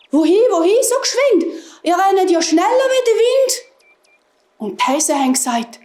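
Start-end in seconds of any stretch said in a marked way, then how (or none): 0.1-3.5 high, excited hedgehog shout; hedgehogs calling out. A narrator's reading. Hedgehogs calling to the fleeing hares, high excited calling. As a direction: call after runners in a high voice. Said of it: "haben gerufen".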